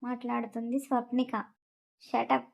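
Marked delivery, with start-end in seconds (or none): none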